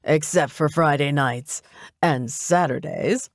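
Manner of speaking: slow, teasing afterthought with a swoop up at the end; playful lilt. A narrator's reading. Derek jokes to Mitzi about his weekends, playfully lilting. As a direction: tease it out slyly. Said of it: "said with a playful lilt".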